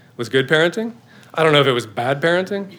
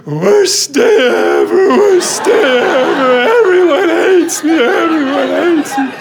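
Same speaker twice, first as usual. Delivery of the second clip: high mock-wailing whine, much higher and louder; imitating a wailing kid; 12 semitones higher, 3 dB louder, slower than usual. Dad imitating his son wailing while carried off. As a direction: ham up a sobbing child's lament. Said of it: "he starts wailing".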